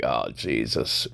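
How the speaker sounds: low, soft, slow muttered aside; the cabbie's tired sigh of a comment; weary cab driver. Retold or imitated. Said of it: "The cab driver's looking like".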